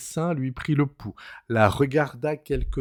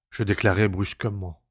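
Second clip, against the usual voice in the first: usual speaking voice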